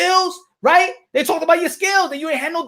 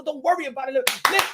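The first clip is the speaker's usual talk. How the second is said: usual speaking voice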